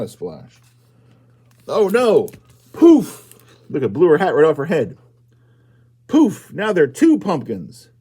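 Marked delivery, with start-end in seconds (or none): 2.7-3.3 high, loud burst of a poof. Acted. The witch's brew explodes, loud high poof. 6.1-6.5 high, loud burst of a poof. Acted. The witch's brew explodes, loud high poof.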